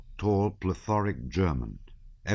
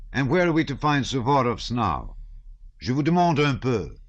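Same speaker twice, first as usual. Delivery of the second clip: sardonic wit; 7 semitones higher, 5 dB louder than usual. A narrator's reading. Shinshin mocking the generals.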